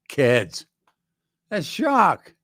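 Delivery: high, loud mock mother voice; hammed-up mom insisting it's the same dog; Patsy's insistence. Patsy assuring her kids the swapped dog is Jacques, high and loud mock.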